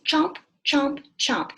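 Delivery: loud, punchy chomp chomp chomp; chomping sound. Shown as a demonstration. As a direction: bite the sound out loud like eating. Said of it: "Can you say that?".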